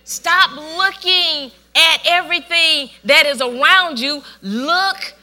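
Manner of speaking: loud, slow, driving preacher voice; full-force preaching. Acted. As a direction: preach it straight at the congregation.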